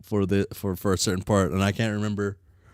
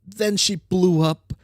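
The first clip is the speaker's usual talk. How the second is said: put-on bright, higher girl character read; troll girl character voice; 9 semitones higher than usual. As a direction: voice the troll with cocky energy. Reads game character lines.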